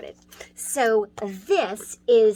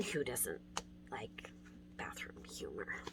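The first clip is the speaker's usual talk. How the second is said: much softer, lower whisper; 8 semitones lower, 20 dB quieter than usual. Real, not acted. Teacher joking about bathroom humor, hushed whisper. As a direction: drop to a cheeky hush for the joke.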